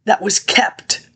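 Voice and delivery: slow, breathy whisper of a secret. Real, not acted. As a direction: let it drop into a whisper. Secretive aside about kept woman.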